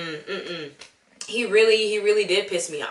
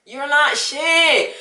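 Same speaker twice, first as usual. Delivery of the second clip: laughing disbelief; 6 semitones higher, 4 dB louder, much slower than usual. Real, not acted. Laughing at the movie character.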